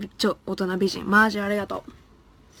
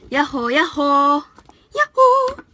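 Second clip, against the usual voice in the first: slow, much higher yoo-hoo call; high sing-song yoo-hoo; 5 semitones higher, 7 dB louder, slower than usual. Pretend. Greeting a viewer in chat with a slow, high yoo-hoo. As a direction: stretch it out like a cheery call.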